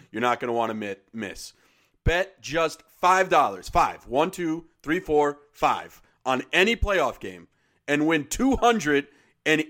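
upbeat sponsor read, punchy. Acted. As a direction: sell it like a radio spot.

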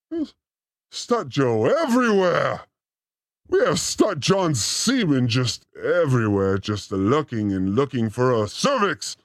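ordinary talk, nothing marked

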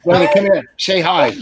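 loud call to his daughter. Real, not acted. Calling daughter from another room.

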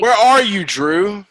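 calling out. Pretend. Host hollers for absent Drew, calling out. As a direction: call out for the missing friend.

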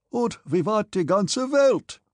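high, slow cheering shout in German; jubilant cry. A narrator's reading. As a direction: cry it out joyfully, stretching the words. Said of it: "and cried, laughing".

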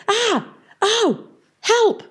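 high yelps, then a cry for help; panicked cries. A narrator's reading. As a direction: yelp in alarm, high and sudden. Peavy falls into the bog.

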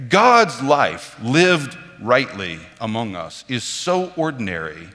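usual speaking voice

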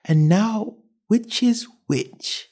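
put-on high, slow pondering little-girl voice; high musing to oneself; talking to herself. A narrator's reading. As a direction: puzzle it over slowly, pitched high. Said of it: "she said to herself".

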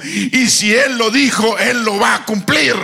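fervent testimony delivered from the pulpit; impassioned preaching. Acted. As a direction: proclaim it with full conviction to the congregation. Preaching the mother's faith at force.

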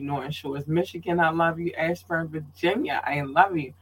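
ordinary delivery

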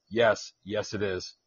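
mocking the co-host's low flat drone; slow deadpan parody of a bored reply. Host mocking his co-host's flat answer, slow and deadpan. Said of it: "That's called an engaged co-host".

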